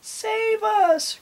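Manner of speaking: extremely high, drawn-out squeal; pleading cry. A narrator's reading. Jailed pirates to their rescuers, high pleading cry. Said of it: "cried the pirates".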